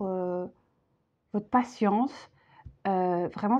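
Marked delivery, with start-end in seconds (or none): none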